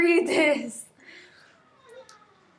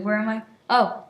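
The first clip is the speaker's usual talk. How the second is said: ordinary delivery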